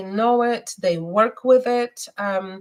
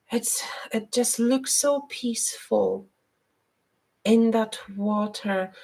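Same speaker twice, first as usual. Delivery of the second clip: whispered, dreamy aside; hushed; 4 dB quieter than usual. Real, not acted. Psychic describing a vision to Angel, whispered. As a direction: breathe it out as a private vision. Envisions peaceful water in reading.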